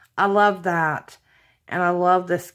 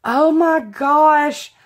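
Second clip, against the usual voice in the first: slow, loud, higher exclamation; amazed; 6 semitones higher, 6 dB louder, much slower than usual. Real, not acted. Crafter marvelling at a gorgeous gift, amazed. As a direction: draw it out in amazement.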